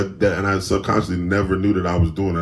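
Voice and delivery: ordinary talk, nothing marked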